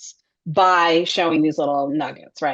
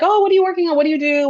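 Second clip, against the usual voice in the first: eager question; 10 semitones higher, 5 dB louder, much faster than usual. Retold or imitated. Classmates at reunion, retold.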